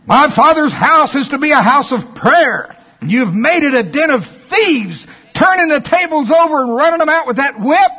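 high, loud, forceful rebuke; Jesus rebuking the money changers, loud and angry. Retold or imitated. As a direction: drive them out of the temple with your voice. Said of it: "Do you think that's how it was?".

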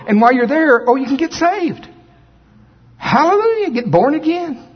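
high fervent preaching; preaching at full force. Acted. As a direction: preach with rising fervor.

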